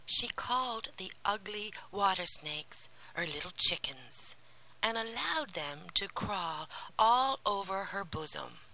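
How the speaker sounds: ordinary delivery, nothing marked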